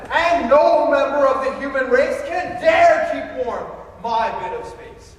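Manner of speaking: loud, high, rushing declamation; grandiose outburst. Acted. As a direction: proclaim it like a wounded ego.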